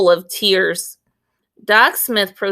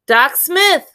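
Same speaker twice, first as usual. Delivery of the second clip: high, louder call; 8 semitones higher, 4 dB louder than usual. A narrator's reading. Willie calls to the doctor, high and loud. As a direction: call her from the road. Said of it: "little Willie called out".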